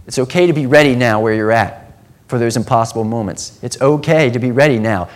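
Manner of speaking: ordinary talk, nothing marked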